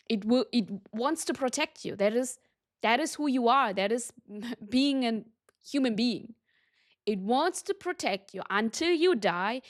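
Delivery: ordinary delivery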